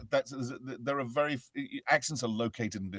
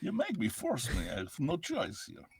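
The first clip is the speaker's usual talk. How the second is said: Russian villain; 9 semitones higher than usual. Acted. Villain claiming he has no choice, Russian accent. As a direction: protest helplessly in a hammed-up Russian accent. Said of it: "Russian vision villain will say".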